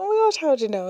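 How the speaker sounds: delighted. Retold or imitated. Retelling delight at sister's gift.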